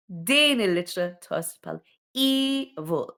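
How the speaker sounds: "Din litra tas pal evil" spelled out slowly, teacher style; careful teacher reading of Maltese; slow Maltese model reading. Shown as a demonstration. Maltese letter line read as model.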